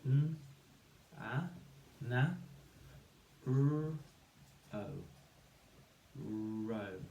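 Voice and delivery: each sound of NA and ROW alone, then blended. Shown as a demonstration. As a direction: separate sounds with long gaps, building NA then ROW. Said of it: "say the sounds to build the syllables".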